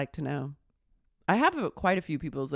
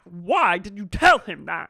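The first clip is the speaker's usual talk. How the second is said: loud demand at usual pitch; mock outraged question; 8 dB louder than usual. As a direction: confront him with a raised voice. Retelling Sheena confronting Brock.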